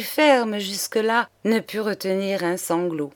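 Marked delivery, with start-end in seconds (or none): none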